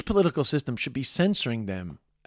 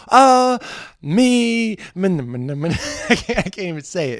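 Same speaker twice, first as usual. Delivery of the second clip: laughing; 7 semitones higher, 8 dB louder than usual. Real, not acted. Cracking up mid-joke.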